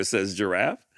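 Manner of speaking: plain reading voice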